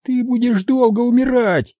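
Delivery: high, taunting female villain; mocking captor's voice; Maria's voice. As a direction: sneer it down at him. Maria threatening.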